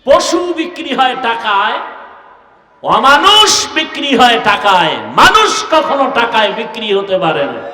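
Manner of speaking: loud, high proclamation; punched openings that fall away; full-force preaching. Acted. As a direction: hurl each phrase at the congregation.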